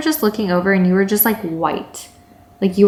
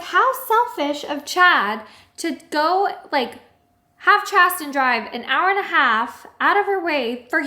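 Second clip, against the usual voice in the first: high-pitched indignant complaint, retold; mildly acted upset, pitched up; 7 semitones higher than usual. Girlfriend retelling her complaint about her boyfriend's selfishness, upset. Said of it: "I got even more upset".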